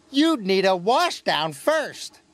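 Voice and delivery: high, slow, teasing jibe; chuckling tease. A narrator's reading. James needles dirty Gordon, high and teasing. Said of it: "chuckled James".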